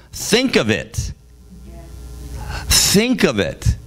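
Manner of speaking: slow, weighty "think"; dramatic pause phrase. Acted. Jesus pausing mid-speech to make the crowd ponder. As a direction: stop and make them ponder. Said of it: "Jesus pauses right in the middle".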